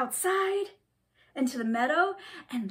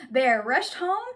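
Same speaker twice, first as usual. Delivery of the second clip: usual speaking voice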